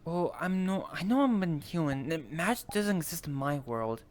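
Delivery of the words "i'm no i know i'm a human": ordinary delivery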